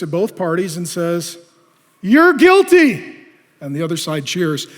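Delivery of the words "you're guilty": loud, high, very slow pronouncement; stern verdict. Acted. Preacher's Jesus sentencing both parties, loud and drawn out. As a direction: drag out the sentence like a judge.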